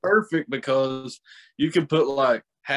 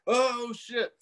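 sudden high yelp of fright; mock alarm; 10 semitones higher, 3 dB quieter than usual. Telling young guys about turning thirty, a high startled yelp. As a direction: squeak it out like the coaster just dropped. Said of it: "you're like".

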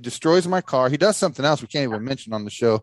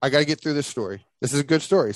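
usual speaking voice

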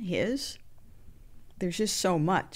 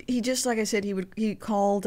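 ordinary delivery

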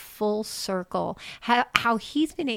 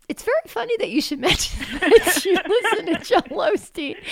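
laughing through the words, pitched high; cracking up; 9 semitones higher, 5 dB louder than usual. Retold or imitated. Telling Connie the funny coincidence, cracking up. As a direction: retell it breaking into giggles. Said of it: "And then I start cracking up".

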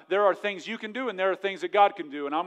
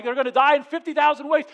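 mock panicked worry; 9 semitones higher, 8 dB louder than usual. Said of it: "And I'm like".

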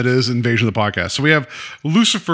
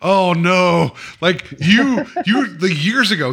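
mock alarm; 6 semitones higher, 3 dB louder, slower than usual. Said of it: "He was like".

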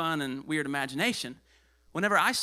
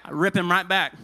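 loud, firm preacher's call; loud preaching; 8 dB louder than usual. Real, not acted. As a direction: hammer it out. Preaching at full force.

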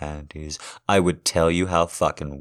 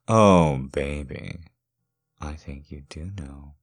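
ordinary talk, nothing marked

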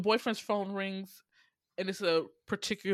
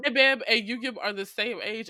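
ordinary delivery

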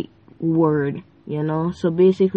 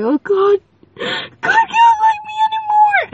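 high crying wail of panic; high, loud panicked cry to God; 5 dB louder than usual. Retold or imitated. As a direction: cry out that God has gone.

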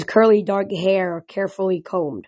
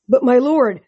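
louder, higher protest; 5 semitones higher, 5 dB louder than usual. Acted. Selenius objecting to the god of wine, a loud protest. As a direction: object sharply, pitched up. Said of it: "Selenius protested".

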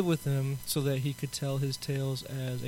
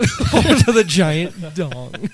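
words stretched out through laughter; laughing; 10 dB louder, much slower than usual. Real, not acted. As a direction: crack up while repeating it. Repeats joke while laughing.

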